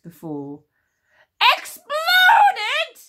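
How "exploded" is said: shrieking high loud cry of horror. Acted. Queen Sycorax hearing her daughter exploded, horrified shriek. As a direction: scream the word in disbelief. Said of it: "she said in horror".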